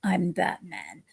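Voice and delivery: slow, low gravel Batman. Retold or imitated. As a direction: drop low and drag it out like Batman. Deep Batman line.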